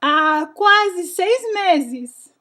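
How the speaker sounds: usual speaking voice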